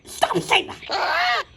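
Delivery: a child playing parent scolds the toys; pretend scolding.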